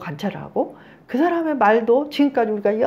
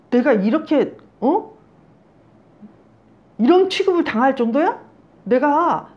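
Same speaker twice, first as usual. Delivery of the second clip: indignant, raised voice demanding answers; acted angry outburst; 5 semitones higher, 5 dB louder than usual. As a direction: play someone flaring up at being slighted. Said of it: "화가 팍 나면서".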